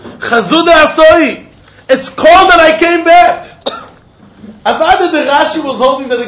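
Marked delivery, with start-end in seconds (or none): 0.0-3.6 high, loud, slow calling out; mock yell. Man yelling to the rabbi across the river, mock yell. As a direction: call out that you came back. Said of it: "This is what he was exactly saying".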